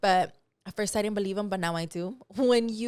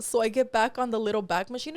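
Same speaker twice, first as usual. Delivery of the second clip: usual speaking voice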